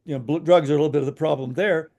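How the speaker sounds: ordinary delivery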